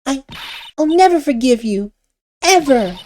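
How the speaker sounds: put-on high girlish voice, wounded and reproachful; hurt little girl Pearl. Pearl tells Nick she was wrong about him, high wounded girl voice.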